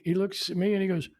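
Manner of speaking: ordinary delivery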